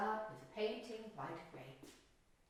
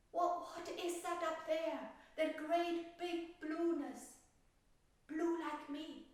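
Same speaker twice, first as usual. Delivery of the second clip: lightly higher, slowed wondering question; a hint of a timid little flower asking; worried little violet; 9 semitones higher, slower than usual. Violet asking the lamb about the sky, lightly higher. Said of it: "And the violet said to the lamb".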